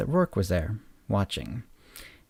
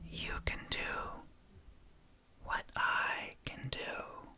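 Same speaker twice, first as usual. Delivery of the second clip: slow, deliberate whisper; hushed, menacing secret in a whisper; 15 dB quieter, slower than usual. A narrator's reading. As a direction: lean in and whisper it slowly. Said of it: "He whispered".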